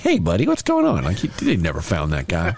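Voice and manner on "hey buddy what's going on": much higher, timid cheerful greeting; tough-on-the-phone guy caught meek in person; meek friendly guy. Retold or imitated. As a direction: squeak it out like a pushover. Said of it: "in person, he's like".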